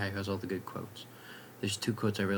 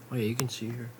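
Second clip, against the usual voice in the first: normal talking voice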